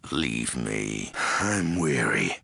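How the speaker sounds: ordinary delivery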